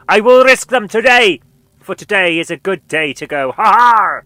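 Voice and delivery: loud, hammed-up pirate bravado; defiant pirate. Captain defying piracy charges, hammy pirate shout. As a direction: bellow it like a stage pirate. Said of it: "you risk charges of piracy".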